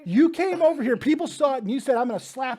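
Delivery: high, loud playful accusation; dad hamming up a fake accusation of his daughter; mock accusation. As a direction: pile on the fake charges.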